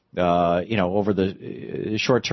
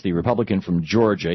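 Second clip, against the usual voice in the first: normal talking voice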